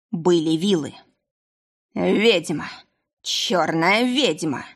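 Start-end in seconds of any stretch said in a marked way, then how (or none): 1.9-2.7 put-on thick drunken yell; drunken bellow. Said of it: "возопил Вель не своим голосом". 3.2-4.6 slow dragged-out howl; drawn-out drunken accusation; drunken bellow. Acted. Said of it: "возопил Вель не своим голосом".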